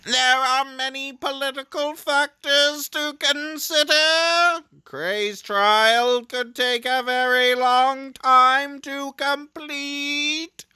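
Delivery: high, slow voiced game character. Reads game character dialogue.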